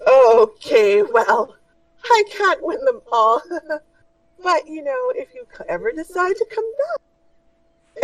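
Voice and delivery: a dainty merchant offering a fix; high shopkeeper voice. Acted. Shopkeeper assuring the party, high and fussy.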